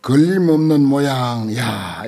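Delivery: ordinary talk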